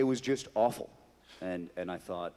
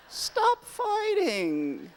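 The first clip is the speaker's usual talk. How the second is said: much higher, laughing, drawn out; high laughing plea; 4 dB louder, much slower than usual. Acted. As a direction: squeal it with a laugh.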